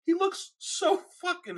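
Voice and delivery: mock dad losing it to laughter; angry turning to laughter. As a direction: start angry and break up laughing. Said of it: "it turns to laughter".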